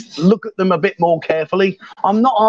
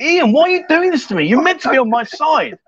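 mock outrage; 7 semitones higher than usual.

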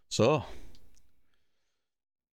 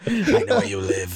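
creepy stalker; 3 semitones lower, 5 dB louder, slower than usual. Pretend. Joking about stalking her on police computers.

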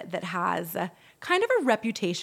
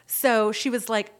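usual speaking voice

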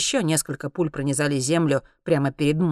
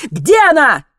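high, very loud demand; shouted question; 11 semitones higher, 12 dB louder than usual. A narrator's reading. Alex demands to know where she is, shouting. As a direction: yell it to force an answer. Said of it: "крикнул Алекс".

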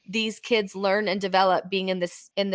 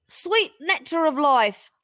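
put-on higher, slow Australian accent; Aussie accent; 7 semitones higher, slower than usual. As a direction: drawl it like an Aussie crab. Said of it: "that's my Aussie accent".

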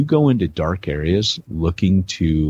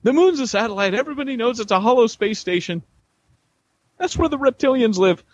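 much higher mock crank voice; conspiracy nut voice. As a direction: ham up a conspiracy theorist. Mocking conspiracy claim.

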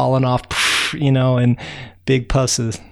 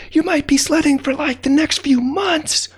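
high called-out warning; high shouted caution; shouting to the ants. Acted. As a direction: call down to the ants. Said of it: "I said".

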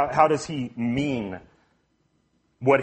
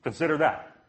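ordinary talk

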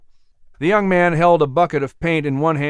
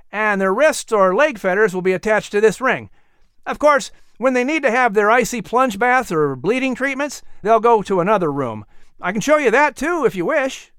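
put-on higher, eager young man's voice; a helpful workman greeting, pitched up; young worker; 7 semitones higher than usual.

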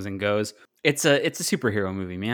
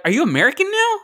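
high-pitched incredulous mock question; 4 dB louder than usual. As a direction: pitch leaps up as if baffled by her. Mock challenge to actress's accent.

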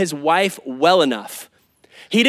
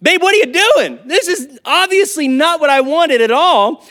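higher and louder mock exasperation; exasperated wife; 8 semitones higher, 4 dB louder than usual. Wife scolding husband over wrong groceries, higher mock exasperation. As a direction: play the fed-up wife scolding her husband. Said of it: "she says".

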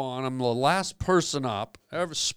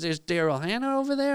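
much higher, excited mock Lovitz bragging; Jon Lovitz impression; 5 semitones higher than usual. Lovitz in the wings bragging about his club, high mock voice. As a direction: pitch it way up like a proud club owner. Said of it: "And he goes".

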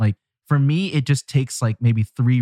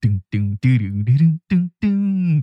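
bell ringing; slower than usual. Pretend. Hums song riff after title.

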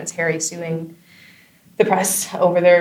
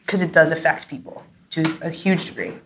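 ordinary talk, nothing marked

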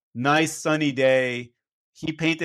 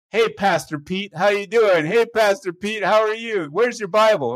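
higher-pitched, sing-out teasing greeting; making fun; 6 semitones higher than usual. Retold or imitated. Regular customer teases the pastor at each visit, mocking. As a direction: imitate a guy needling the pastor. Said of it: "he'd make fun of me".

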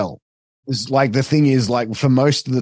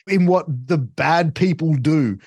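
exasperated explaining; 6 semitones higher than usual. Retold or imitated. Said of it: "I said".

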